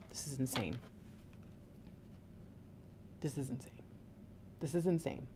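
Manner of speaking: very soft, near-whispered awe; stunned disbelief. Real, not acted. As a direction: murmur it to yourself, stunned. Awed, muttering at the cards.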